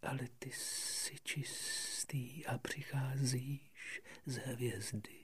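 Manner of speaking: hushed, slow snake whisper. Acted. Had mluví šeptem. (šeptající had)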